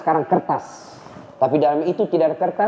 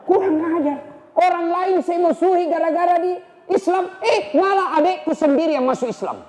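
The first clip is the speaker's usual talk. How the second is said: angry Umar; 14 semitones higher than usual. Acted. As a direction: let the fury burst out. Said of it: "marah Umar".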